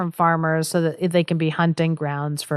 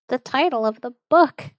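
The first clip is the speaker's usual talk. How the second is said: gasp, then higher mock-awed remark; mock amazement; 6 semitones higher than usual. Co-host greets the title's appearance, mock amazement. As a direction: act thrilled at a big reveal. Mock thrill at titular line.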